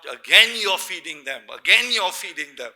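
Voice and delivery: loud scolding cook's shout; retold shout. Cook shouting at the kids for being fed again, loud. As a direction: bellow it at the children like an exasperated cook. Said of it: "he had to shout".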